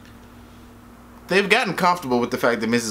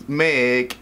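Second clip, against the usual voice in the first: usual speaking voice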